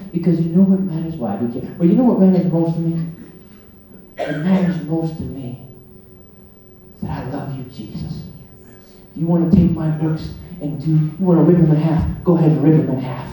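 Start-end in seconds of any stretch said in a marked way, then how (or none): none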